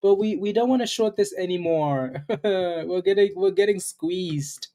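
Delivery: high-pitched, slowed-down mock panic voice. Trader acts out panicking short sellers for viewers, mock panic. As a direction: play panicking traders in a high voice, laughing partway. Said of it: "the shorts start panicking".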